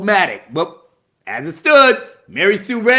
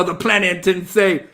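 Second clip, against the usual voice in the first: normal talking voice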